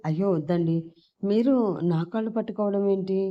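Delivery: plain reading voice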